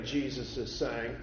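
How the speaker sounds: ordinary talk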